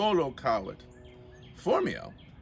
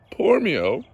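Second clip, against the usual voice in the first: usual speaking voice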